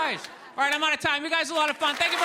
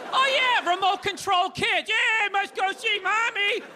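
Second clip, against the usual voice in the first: higher, slow mock-breezy ad voice; mock casual; 6 semitones higher, slower than usual. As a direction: play it like nothing weird happened, slow and high. Mocking the ad's casual tone.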